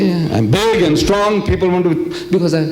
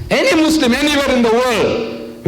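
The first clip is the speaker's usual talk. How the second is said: very high, ringing preacher's call; impassioned challenge; 10 semitones higher than usual. Real, not acted. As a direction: throw it out high to the whole crowd. Preacher challenging the whole audience.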